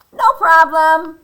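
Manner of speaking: high, loud, slow bird voice; cheery bird reply. Acted. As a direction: sing out an easy-going answer. Birds answer in speech bubble.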